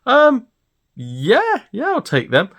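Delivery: his own voice pushed high in disbelief; a squeaky, stunned yes; flustered agreement. Retold or imitated. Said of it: "And I was like".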